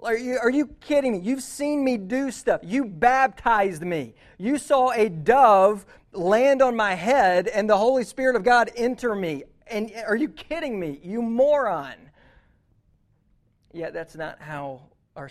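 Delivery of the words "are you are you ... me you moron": loud, higher, exasperated mock rant; angry outburst. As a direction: blow up at him like an outraged teacher. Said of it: "surely Jesus is angry at that".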